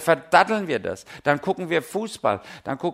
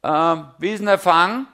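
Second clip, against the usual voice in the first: Jesus asking; 4 semitones higher, 7 dB louder than usual. Retold or imitated. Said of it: "Und dann fragte sie".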